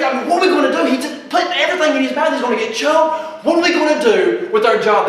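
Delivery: hammed-up panic, loud and high; freaking out. Wife coming to her husband about their troubles, freaking out. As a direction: fret at full volume, piling up worries. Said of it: "he's freaking out".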